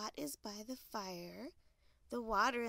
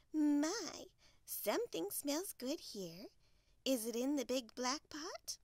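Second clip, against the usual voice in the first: higher hen voice, louder on the opening word; an impressed, curious hen, pitched up; 5 semitones higher, 4 dB louder than usual. Acted. Hen smells Little Bear's soup, curious hen voice.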